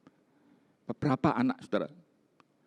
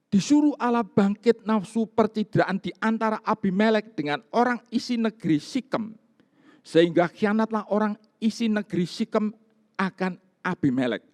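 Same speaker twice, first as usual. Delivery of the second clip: raised, louder reading of the verse; solemn scripture reading; 7 semitones higher, 4 dB louder than usual. Acted. Pastor reads the verse to the congregation, in a raised solemn reading voice. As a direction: proclaim the verse to the hall.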